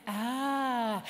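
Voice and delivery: long, open ah of wonder. Shown as a demonstration. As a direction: breathe out a drawn-out wondering ah. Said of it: "hands come up with".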